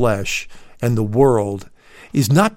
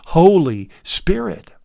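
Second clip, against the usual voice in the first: "Holy Spirit" lifted a little and leaned on; underlined Holy Spirit; 4 semitones higher than usual. Shown as a demonstration. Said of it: "I want to underline that word".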